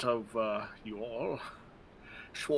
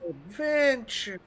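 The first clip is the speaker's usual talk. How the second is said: high, slow, grand "Adventure"; dramatic announcement; 11 semitones higher, 6 dB louder, slower than usual. Pretend. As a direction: announce it like a game trailer. Announcing new adventure playfully.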